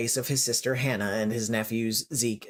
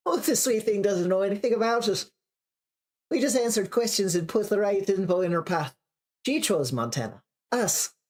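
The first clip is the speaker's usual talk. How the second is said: sing-song Irish lilt, pitched up; Irish accent; 7 semitones higher than usual. Acted. Dahlia telling the detective Maggie knows nothing, in a sing-song Irish accent. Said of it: "so she's Irish now".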